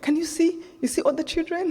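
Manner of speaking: a father urging his daughter to look, voice lifted; eager call to daughter. Retold or imitated. Man calls to his daughter.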